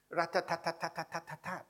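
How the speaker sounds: high, even rat-tat-tat; syllable-timed rhythm. Shown as a demonstration. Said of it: "They speak like this".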